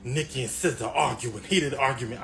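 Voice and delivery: normal talking voice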